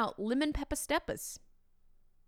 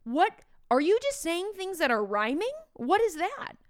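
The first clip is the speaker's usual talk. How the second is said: much higher, louder disbelief; baffled; 8 semitones higher, 5 dB louder than usual. Real, not acted. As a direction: throw up your hands in confusion. Baffled reaction to kids' rhyme.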